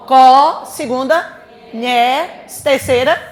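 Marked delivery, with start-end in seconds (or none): 0.1-0.6 "Co" loud and held as one syllable; syllable sounded alone. Shown as a demonstration. Teacher splitting a word for the class, syllable sounded alone. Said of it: "Como é que a gente separa". 1.7-2.4 "nhé" held as a separate syllable; syllable sounded alone. Shown as a demonstration. Teacher counting syllables for the class, syllable sounded alone. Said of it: "Como é que a gente separa".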